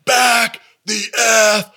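high, drawn-out holler; retold scream, slow word by word; mock repeated yell. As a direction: imitate yelling it across a ballfield. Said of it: "the second time he says it".

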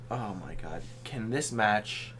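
low, soft, fed-up muttering; quietly begging for it to end; weary exasperation. Retold or imitated. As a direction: sigh it out low, worn down. Acts out own weary reaction.

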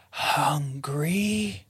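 whispered, slow ghost voice; ghostly whisper. Pretend. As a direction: breathe it like a ghost. Reading ghost answer options.

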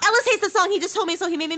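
loud excited outburst. Real, not acted. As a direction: blurt it out, high and loud.

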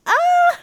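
put-on hurt child's shriek; little girl's scream. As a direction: wail like a kid who just hit her head. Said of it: "she's like".

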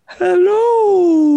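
high, loud ghostly hello; spooky ghost voice, hammed up; playful spooky voice. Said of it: "That's his ghost voice".

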